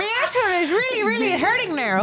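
a high, hammed-up Irish accent; squeaky put-on Irish lilt; mock Irish accent. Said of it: "how an Irish guy would have".

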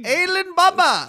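high, slow put-on Indian accent; drawn-out playful Indian-accent name; mock Indian accent. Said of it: "you're fully going".

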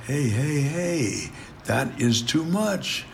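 softly, slowly reining someone in; soft, measured telling-off, retold; retold gentle caution. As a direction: stop them gently, stretching the warning out. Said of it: "I'd say".

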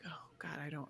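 very soft, worried mutter; anxious murmur. Retold or imitated. Norwegian colleague fearing the camel, hushed anxious murmur. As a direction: lightly imitate a nervous colleague, hushed. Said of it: "he was just like".

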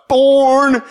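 high, loud, held-out yell; shout. A narrator's reading. Shai star-jumping into the camcorder lens, exuberant shout. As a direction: belt the word out like an exuberant kid. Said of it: "he star jumps and shouts".

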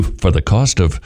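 lightly mimicked low, quick appeal voice; charity appeal pitch. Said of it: "I'm not gonna try to do that".

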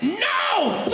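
sudden high yelled no; father's shout. Retold or imitated. Father yelling at his goth daughter at the cemetery, high mock shout. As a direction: bark it like an angry dad mid-argument. Father's yell cuts in again.

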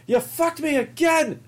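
high, slow whiny complaint; hammed-up wounded whine; mock betrayed owner. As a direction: drag out the grievance in a squeaky voice. Voicing betrayed owner again.

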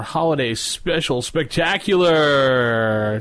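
slow, drawn-out announcer voice; fighting game announcer. Acted. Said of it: "There, there's your announcer voice for you".

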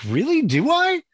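high, incredulous "Really? Do I?"; surprised. Retold or imitated. Retelling to a friend how he reacted to his girlfriend, surprised and high. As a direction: caught off guard, asking twice.

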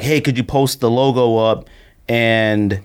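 ordinary delivery

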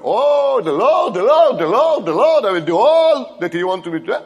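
high, loud, hammed-up pious gushing; a hammed-up sanctimonious Balaam; mock piety. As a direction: overdo the holy talk mockingly. Mocking Balaam's pious show.

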